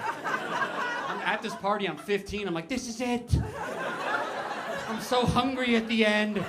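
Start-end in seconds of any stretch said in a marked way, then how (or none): 2.7-6.4 high-pitched melodramatic last words; hammed-up panic. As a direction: cry out as if you think it's the end. Acts out teenage panic at party.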